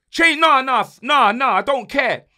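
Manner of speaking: loud, high outburst, cutting himself off; exasperated shout. Real, not acted. Fan yelling about the manager's formation, exasperated. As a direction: blurt it out, fed up.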